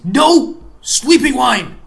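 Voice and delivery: sudden loud protest; loud outburst. Acted. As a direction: shout it out in alarm. DM reacts loudly in character.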